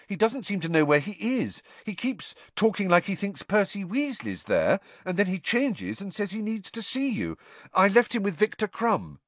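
high, earnest explanation; Harry, worried. A narrator's reading.

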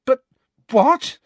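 loud, very high outraged protest; furious. A narrator's reading. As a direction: burst out against the injustice. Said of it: "furious at this injustice".

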